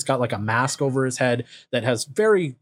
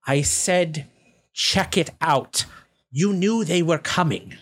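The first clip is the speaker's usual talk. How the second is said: higher, deliberate goblin character voice; measured goblin reprimand; goblin camp leader; 4 semitones higher than usual. Acted. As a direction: scold a minion, pitch raised. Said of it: "the bugbear looks confused. He says".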